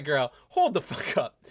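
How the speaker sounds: ordinary talk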